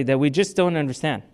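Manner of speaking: ordinary talk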